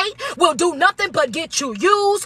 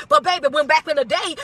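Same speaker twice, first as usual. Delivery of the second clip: ordinary talk, nothing marked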